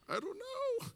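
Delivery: very high, tiny squeak; mock cluelessness. Answering brother over dad's bed, squeaky cluelessness. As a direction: squeak it out as if baffled. Silent exchange with brother, baffled.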